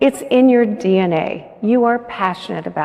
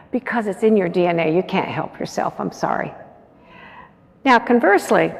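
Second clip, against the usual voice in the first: normal talking voice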